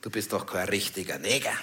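dismissive friend, voiced low and soft. Comedian quotes Kurti brushing him off, low and soft. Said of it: "Da sagt er zu mir".

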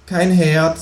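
high, chirpy kangaroo. A narrator's reading. Kangaroo asking the neighbour for eggs, high voice. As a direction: lift the voice way up for the kangaroo. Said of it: "sagt das Känguru".

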